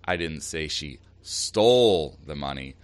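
pause, then hit "stole" long and hard; stress on STOLE. Shown as a demonstration. Host giving an example of shifting meaning, stress on "stole". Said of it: "emphasizing one word in that sentence".

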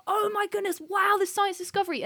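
gushing in a high, light voice; mock excitement. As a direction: imitate a crew thrilled by a breakthrough. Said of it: "People would have been high-fiving".

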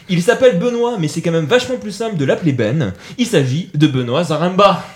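announcer reading a build-up; guest introduction. Acted. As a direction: present the guest with flair. Reads a show presentation.

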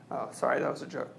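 normal talking voice

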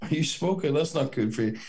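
lightly voiced doggy voice. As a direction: tease the dog like a baby. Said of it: "I do it in a wee doggy voice".

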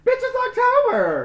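high, loud, slow cheer; excited shout. Real, not acted. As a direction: shout it out in celebration. Announcing October, excited.

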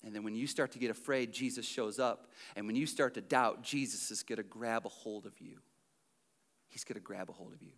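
low, soft comforting murmur; hushed reassurance. Real, not acted. Preacher to the doubting and afraid, hushed reassurance. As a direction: reassure them quietly, fading away at the end.

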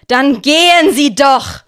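loud, slow, desperate shout; desperate scream. A narrator's reading. Herr S, at the end of his rope, screams at the two men. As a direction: scream them out of the house in despair. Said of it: "schrie Herr S in seiner Verzweiflung".

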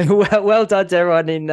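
real amused laugh in the voice. Real, not acted. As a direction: laugh your way into the congratulations. Speaker breaks up laughing mid-sentence.

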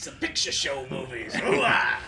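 slow gravel-free growl ending hoo-ah; gung-ho soldier voice. Acted. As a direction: drawl it like a hammy colonel. Pacino impression continues.

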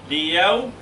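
usual speaking voice

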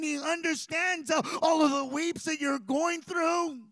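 loud, high, driving sermon delivery with punched phrases; full-force preaching. Real, not acted. Preacher telling the congregation they may question God, impassioned full-force preaching. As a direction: preach it hard, building to an impassioned cry.

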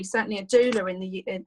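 ordinary talk, nothing marked

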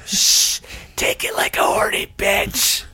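slow, forceful stage whisper; shush, then whisper it slowly in character; play-acted creepy whisper; mock whisper. Roleplays the boyfriend, whispering.

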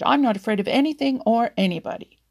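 fast, loud blustering shout. A narrator's reading. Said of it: "he shouted".

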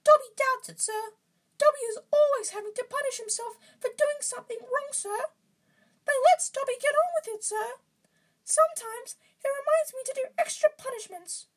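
very high, loud squeaky elf; Dobby. A narrator's reading. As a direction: answer in a squeaky falsetto. Reader acting Dobby's answer.

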